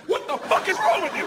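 high-pitched appalled yell; acted-out outrage. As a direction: imitate an appalled wife. Wife's outraged reaction acted out.

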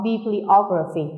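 slow bibliography, stressed on the O after bibli. Shown as a demonstration. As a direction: lean on the middle O in bibliography. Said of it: "nhấn ngay vào chữ O".

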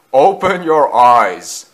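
slow, loud words breaking into laughter; laughing. A narrator's reading. Gordon tells Thomas to look, laughing. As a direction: tell him slowly, then chuckle. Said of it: "said Gordon, laughing".

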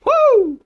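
high-pitched "woo"; high whoop. Real, not acted. Whoop while playing.

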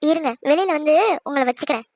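ordinary talk

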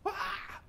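very high, soft mock shriek; small, stifled imitation of a scream. Witch seeing Samuel, mock scream. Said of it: "she screamed".

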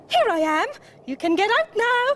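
high-pitched proud announcement. A narrator's reading. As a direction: announce your arrival as a proud little Mole. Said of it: "said Mole proudly".

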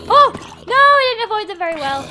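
loud fast alarmed cry; startled gasp. Real, not acted. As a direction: caught off guard by the trap. Steps on pressure plates.

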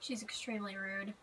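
ordinary talk

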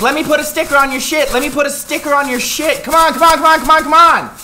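high, loud, pushy huckster voice; pushy salesman mock. Streamer mocking salespeople, pushing stickers on customers. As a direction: hassle the customer to buy.

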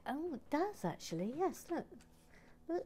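normal talking voice

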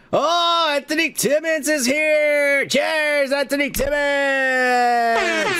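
high, drawn-out toast shout; excited toast. Real, not acted. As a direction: raise a glass and call his name out. Toasting a commenter by name.

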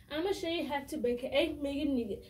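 fast, rushed dance chant. Shown as a demonstration. Showing viewers the sped-up version, fast chant. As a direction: rattle the line off quickly. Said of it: "I'm actually gonna do it more fast".

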